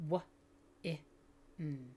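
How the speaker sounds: W, I, n said as single separate sounds; separated sounds. Shown as a demonstration. Teacher tapping out win for a child, sound by sound. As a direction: tap out W, I, n one sound at a time. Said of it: "I tap a finger down for each sound".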